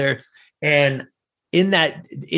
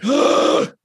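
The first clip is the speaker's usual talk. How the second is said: high, sudden intake of breath; sharp shocked gasp; 13 semitones higher, 3 dB louder than usual. Retold or imitated. Crushed boy hears he wasn't good enough, sharp gasp. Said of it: "lost my breath".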